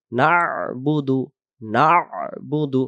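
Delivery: "না'বুদু" slow, with a throaty ain after না; correct ain. Shown as a demonstration. Teacher modelling correct "না'বুদু", slow with throaty ain. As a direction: draw out না into the ain, then বুদু. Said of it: "সেই শুদ্ধ উচ্চারণ হবে".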